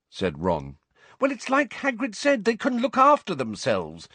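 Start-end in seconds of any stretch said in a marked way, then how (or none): 1.2-4.1 high, exasperated retort; impatient. A narrator's reading. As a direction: brush the objection aside impatiently. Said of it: "said Hermione impatiently".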